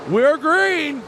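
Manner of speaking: ramp up as the field roars off; excited race call. Real, not acted. Restart calling the field.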